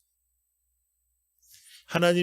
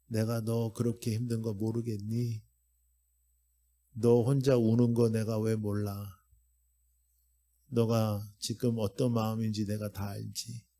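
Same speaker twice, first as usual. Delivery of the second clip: God speaking tenderly; 4 semitones lower, 5 dB quieter than usual. Acted. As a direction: comfort one hurting person as God. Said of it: "하나님께서 오늘도 여러분들에게 말씀하시는 거예요".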